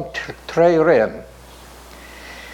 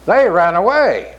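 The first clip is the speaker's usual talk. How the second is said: ordinary delivery, nothing marked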